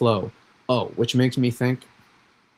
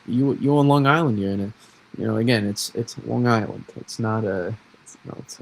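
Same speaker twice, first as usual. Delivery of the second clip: ordinary talk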